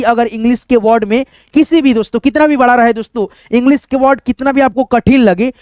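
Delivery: ordinary talk, nothing marked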